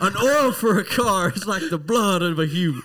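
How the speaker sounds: high, loud imitation of grandpa's lecture; mock chewing-out. Grandpa lecturing a kid about car oil, hollered imitation. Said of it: "chewed you out for oil".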